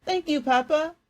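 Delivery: put-on high, bright boy's thank-you; grateful boy, excited. A narrator's reading. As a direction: thank him, thrilled. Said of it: "face lit up with excitement".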